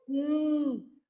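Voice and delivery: the sound "hum" said on its own; a short isolated "hum" sound; the removed sound, on its own. Shown as a demonstration. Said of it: "a minhoca foi lá e comeu o".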